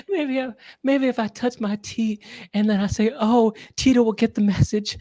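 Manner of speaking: high-pitched mock-desperate voice; hammed-up pleading, building to a wail; pleading stuck man. Man stuck mid-routine hoping Tito hears, mock pleading.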